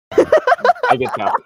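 high squeaky laughing words. Real, not acted. Laughing while teasing.

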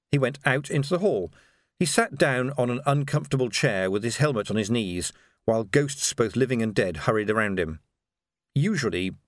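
usual speaking voice

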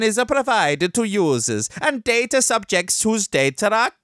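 high cartoon Italian accent; broad mock Italian, sliding slightly off course. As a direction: read the news like a hammed-up Italian. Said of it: "Italian accent indoors with the kids".

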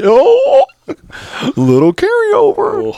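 very high, loud, slow mock squeak. Host mocks the movie's wink in a very high loud squeak. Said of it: "So you're like".

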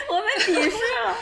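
high giggling complaint; laughing. Real, not acted. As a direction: laugh it out in mock hurt. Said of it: "你口音听不出来".

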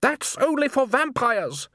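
high, slow, strained retort; exasperated. A narrator's reading. Salzella dismissing pitchforks and scythes, exasperated. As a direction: spell it out impatiently, pitched high.

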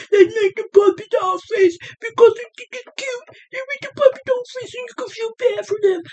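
very high, babyish whiny imitation; mock cutesy whine. Mocking people who make the puppy dog face, high cutesy whine. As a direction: squeal it like someone begging to look cute.

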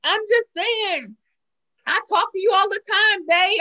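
put-on pleading sweetly in his slight Southern accent; his light accent. Retelling a man calling her babe in his Southern accent. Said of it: "'cause he got an accent".

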